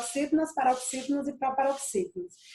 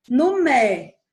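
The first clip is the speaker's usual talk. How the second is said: "mé" slow, louder and held; stress on mé; 6 dB louder than usual. Shown as a demonstration. Teacher trying stress on the middle syllable, "mé" held long. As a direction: lean long on "mé". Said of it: "seria uma paroxítona".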